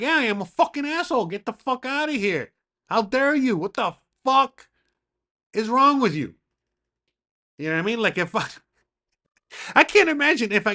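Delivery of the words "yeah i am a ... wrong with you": mock angry rant. Retelling a rude fan confrontation.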